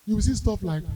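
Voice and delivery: ordinary talk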